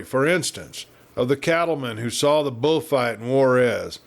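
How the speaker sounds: usual speaking voice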